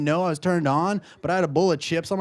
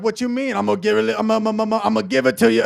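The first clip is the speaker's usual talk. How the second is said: loud, raised rapper impression; 6 semitones higher, 6 dB louder, faster than usual. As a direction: imitate a hype rapper, stuttering into the hook. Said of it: "just turn into D M X".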